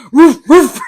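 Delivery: loud laughing woof woof; playful dog barks. Pretend. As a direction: bark it out like a happy dog. Louder repeat of barking.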